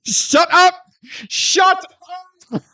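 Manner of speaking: high yelling shut up; mock shout. Acting out the gunslinger duel.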